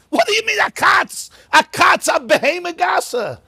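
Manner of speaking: high outraged shout; incredulous shout at a shocking claim; shocked objection. Acted. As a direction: demand it in disbelief, pitch jumping up.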